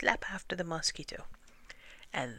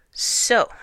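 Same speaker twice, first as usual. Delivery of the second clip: booming, drawn-out announcement; big royal pronouncement; kingly proclamation; 8 semitones higher, 13 dB louder than usual. A narrator's reading. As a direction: proclaim it loudly to the whole council. King pronounces verdict to council.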